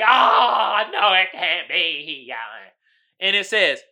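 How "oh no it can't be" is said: mock horror. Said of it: "the one dude on the right is like".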